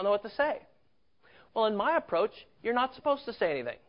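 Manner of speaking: rising sermon fervor; emphatic preaching. Acted. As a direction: drive the point home to the congregation.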